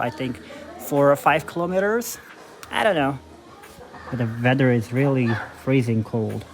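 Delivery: slow, careful put-on American accent; showing off an American accent, slowly. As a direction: speak English in a deliberate American accent. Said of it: "I speak in American accent".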